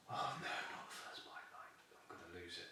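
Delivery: whispered worry, fading away; hushed dread. Retold or imitated. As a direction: breathe it out afraid. Fearing losing his first bite.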